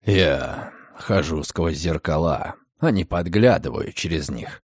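coolly unbothered deep voice; unruffled night visitor. A narrator's reading. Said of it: "невозмутимо сказал ночной визитёр". (невозмутимо, низким голосом)

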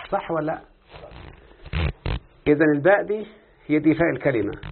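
usual speaking voice

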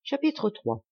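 usual speaking voice

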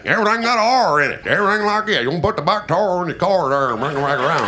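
North Georgia twang, R in everything; R-heavy North Georgia impression; North Georgia accent. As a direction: lay hard R's on every word, North Georgia style. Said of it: "have an R in everything".

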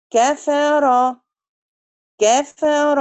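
كفر said slowly with a short a; كفر, short a on the كاف; short fatha demo. Shown as a demonstration. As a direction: stretch the words but keep the كاف vowel short. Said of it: "زي كلمة كفر".

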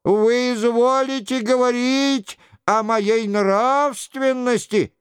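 high, mock-pitiful penitent; plaintive voice. A narrator's reading. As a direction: play the sorry king. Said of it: "жалобным голосом".

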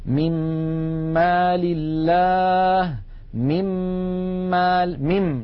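مما chanted very slowly on a long hummed double m; doubled meem. Shown as a demonstration. Teacher demonstrating the meem example, long doubled m in مما. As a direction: sustain the m in مما before opening to the vowel. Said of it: "ننتقل من الميم الأولى إلى الميم الثانية مشددة".

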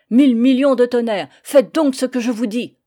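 juron crié, plus haut et fort; ordre tonnant. Acted. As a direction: tonner l'ordre comme un capitaine furieux. Le père donne un ordre. (le père tonne ses ordres aux fils)

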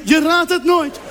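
hoog, slepend uitroepen; opgewonden. Acted. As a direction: roep het ongelovig uit. Brother imagined shouting. (broer roept opgewonden door de telefoon)

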